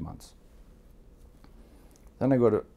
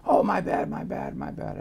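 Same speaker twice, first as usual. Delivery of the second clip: retold apology; 9 semitones higher than usual. Retold friend's hasty apology.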